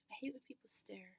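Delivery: whispered complaint. Real, not acted. Venting about people staring.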